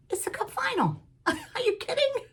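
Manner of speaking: high, incredulous squeal at her son; 아들에게 믿기지 않는다는 듯 높게 꽥 외치는 흉내; mock outrage. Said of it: "I'm like".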